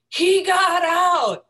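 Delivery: high, loud and slow, sudden alarm; alarmed call. Retold or imitated. Neighbor calling out that her dog escaped, alarmed. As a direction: call it out like bad news. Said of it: "all of a sudden she says".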